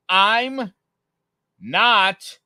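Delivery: high, loud, word-by-word retold protest; acted fed-up denial, slow and emphatic; exasperated. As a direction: space out each word in mock exasperation. Retold showdown with the neighbor.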